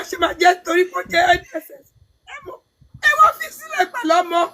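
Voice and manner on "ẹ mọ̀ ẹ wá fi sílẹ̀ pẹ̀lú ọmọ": crying. Real, not acted. Tearful accusation of absent men.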